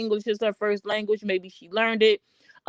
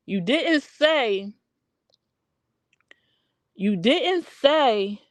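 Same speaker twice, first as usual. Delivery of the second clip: slow emphasis; much slower than usual. Real, not acted. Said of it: "emphatic correction, very slow".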